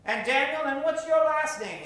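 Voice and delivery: ordinary talk, nothing marked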